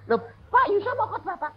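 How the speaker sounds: high, loud pleading; alarmed pastor. Retold or imitated. Pastor panicking at the preacher before the service, high alarmed plea. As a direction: sound panicked that the speaker has nothing ready.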